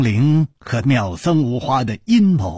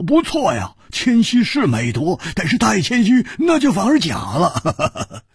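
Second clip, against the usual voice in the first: bright, higher-voiced teasing reply; Hu Tiehua laughing; 8 semitones higher than usual. A narrator's reading. Hu Tiehua teasing the modest guest, mildly laughing. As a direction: chuckle the words out a little higher. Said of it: "胡铁花笑着说".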